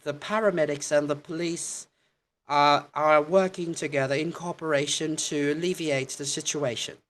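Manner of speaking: crisp high broadcast read; news reporter voice. Shown as a demonstration. Teacher demoing a reporter's delivery for learners. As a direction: read it like a BBC reporter on air. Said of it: "that's how reporters, they do it".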